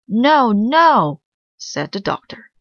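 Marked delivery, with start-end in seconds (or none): 0.1-1.2 high, loud double no; alarmed protest. Acted. Doctor loudly stopping the helper before she stitches. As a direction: cut her off in alarm.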